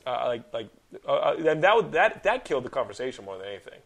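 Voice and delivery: ordinary talk, nothing marked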